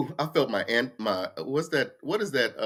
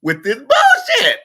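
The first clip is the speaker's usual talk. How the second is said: loud, slow, higher cussing burst; cussing them out; 11 semitones higher, 8 dB louder, slower than usual. Shown as a demonstration. Cussing out churchgoers who won't get it, slow and loud. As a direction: drag each word out and blast it at them. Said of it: "then I got to throw some fucks and shits in there".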